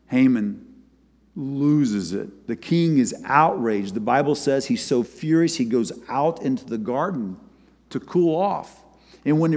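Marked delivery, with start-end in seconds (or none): none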